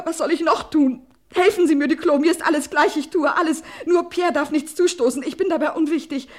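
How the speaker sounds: plead, voice rising in panic; desperate pleading. Acted. Wife begging her husband's friend on the phone, desperate.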